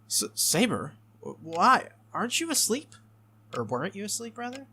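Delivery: acted bewildered questioning; startled. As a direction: stammer out surprise at seeing her up. Startled to find Saber awake.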